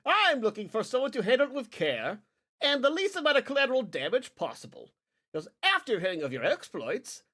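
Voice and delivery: high, airy aristocratic voice, pitched well up; lightly hammed-up posh noble; fussy noble lord. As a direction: play a pompous noble fumbling his words.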